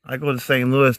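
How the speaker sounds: ordinary talk, nothing marked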